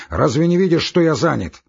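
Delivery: loud, high scolding question; irritated shout. A narrator's reading. Busy postmaster scolds a woman, irritated shout. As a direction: scold loudly, cutting the person off. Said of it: "крикнул он бабе".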